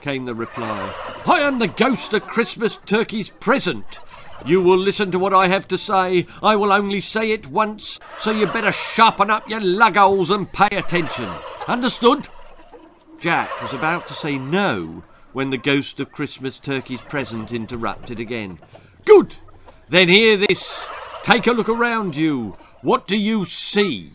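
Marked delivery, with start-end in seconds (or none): 1.2-12.3 high, loud military harangue; overbearing turkey officer; drill sergeant. Acted. Sergeant turkey lectures the new prisoner. 19.0-24.1 high, loud military questioning; overbearing sergeant turkey; drill sergeant. Acted.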